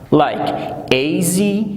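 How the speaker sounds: easy stretched slowly, ea-sy in two beats; slow syllable demo. Shown as a demonstration. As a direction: draw out easy to show its syllables. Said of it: "it has two syllable". («easy» کشیده و آهسته در دو هجا)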